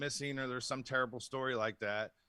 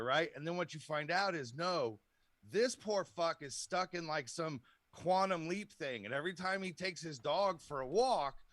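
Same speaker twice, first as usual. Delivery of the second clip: ordinary delivery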